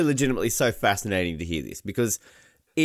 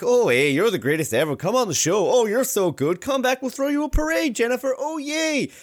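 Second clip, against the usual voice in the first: high, gushing mock praise, rising higher and higher; mocking gushing Canadian; 10 semitones higher than usual. Australian host mocks Canadians, gushing higher and higher. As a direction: ham up an overly nice Canadian cheering the loser on. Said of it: "you're like".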